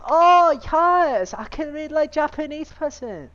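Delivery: high, loud cheer; triumphant shout. Real, not acted. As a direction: celebrate at the top of your voice. Triumphant outburst.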